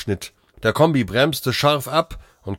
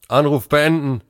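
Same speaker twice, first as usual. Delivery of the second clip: higher, firm order to a device; commanding, raised voice; curt command; 6 semitones higher, 4 dB louder than usual. A narrator's reading. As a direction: order the call ended. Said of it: "kommandierte Kunz".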